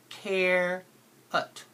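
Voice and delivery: Care-ut with a long CARE and a short lazy ut; stressed first part. Shown as a demonstration. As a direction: lean on Care, then drop ut. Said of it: "It's the stress part".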